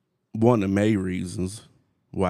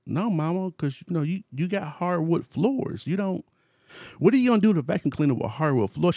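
higher, scrambling explanation; flustered excuse; 10 semitones higher than usual. Retold or imitated. Son dodging his mother's question, pitched up and flustered. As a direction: talk fast to wriggle out of it.

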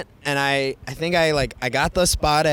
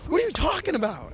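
baffled disbelief; 13 semitones higher, 4 dB quieter than usual. Retold or imitated. Hat owner retelling being corrected, baffled high disbelief. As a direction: play back your confusion with the pitch leaping up.

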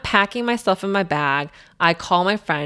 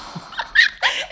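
laughing. Real, not acted. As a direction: burst of high laughter. Telling painful story, laughs.

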